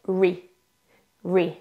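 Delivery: ri said alone as English "ree"; isolated last sound. Shown as a demonstration. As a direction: give ri as a separate syllable, then repeat. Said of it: "une troisième partie avec".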